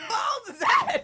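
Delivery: high loud shout of the line; shouted catchphrase. Retold or imitated. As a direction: yell it up high.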